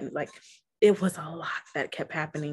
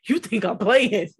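louder, higher mock outrage; defiant challenge; 4 semitones higher, 7 dB louder, slower than usual. Pleading with God for a sign, acted defiant challenge. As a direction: act out calling someone's bluff, loud.

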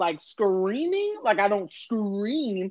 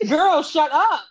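laughing quote; 7 semitones higher, 6 dB louder, slower than usual. Retold or imitated. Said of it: "It was just".

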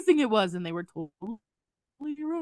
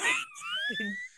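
squealing laugh; 8 dB quieter than usual. Real, not acted. Laugh after defiant quote.

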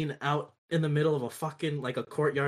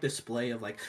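normal talking voice

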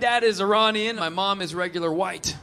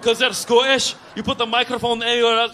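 hammed-up Scottish accent. Shown as a demonstration. Comic demonstrating how Scots talk, Scottish accent. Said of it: "By the way, Scottish voice".

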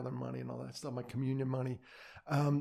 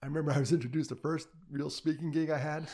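normal talking voice

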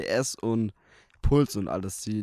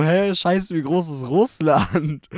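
shocked; 5 semitones higher, 5 dB louder, much slower than usual. Retold or imitated. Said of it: "Und dann denk ich so".

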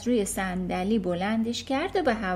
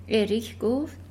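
usual speaking voice

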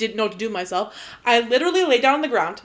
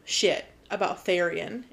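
ordinary delivery

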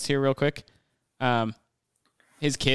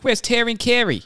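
higher, louder hammed-up Boston accent; Mark Wahlberg's Boston accent; 12 semitones higher, 6 dB louder than usual. Quoting the movie character to podcast friends, in a louder, higher mock Boston accent. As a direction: bark "Where's Terry and Carrie?" like a Boston tough guy, pitched up. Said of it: "That was the same exact accent".

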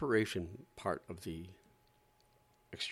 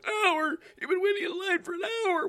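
very high, shrill mock alarm; much higher, gasping fake panic; hammed-up reporter freaking out; panicky reporter; 8 dB louder than usual.

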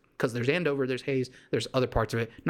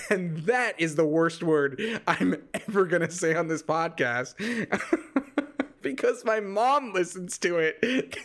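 giggling while getting the words out; laughing through it; 4 semitones higher than usual. Real, not acted. Podcaster laughing at his own swear word because his mom listens. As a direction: laugh through the line, a bit embarrassed.

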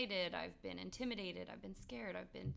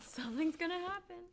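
excited high voice; 11 semitones higher, 4 dB louder than usual. Retold or imitated. Joins in voicing the body.

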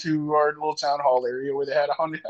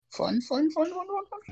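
climbing higher each fun; rising sing-song; 13 semitones higher, 5 dB quieter than usual. Pretend.

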